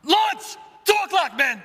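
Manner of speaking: shouting. Acted. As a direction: scream the warning across the trench. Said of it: "And then shout it again".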